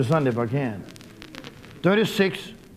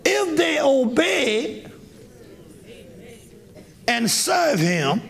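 shouted verse; 8 semitones higher, much slower than usual. Shown as a demonstration. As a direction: proclaim the verse to the hall. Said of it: "Listen to this, listen".

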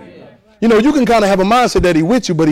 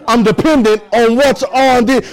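higher and rising, at full force; preaching climax; 6 semitones higher than usual. Real, not acted. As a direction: climb to a fervent peak. Preacher rises to a peak.

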